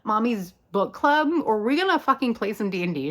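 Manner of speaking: high sassy challenge, easing down; putting him in his place. Acted. [name] challenging Eddie to start playing, sassy. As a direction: challenge him with impatient sass. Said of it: "she puts him in her, his place".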